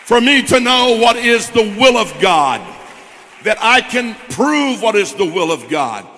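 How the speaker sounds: building from firm to loud, driving pulpit preaching; preaching at full force. Real, not acted. Pastor pressing the church toward the altar, loud preaching. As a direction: preach it to the congregation, rising to a loud climax. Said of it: "I feel like preaching here a second".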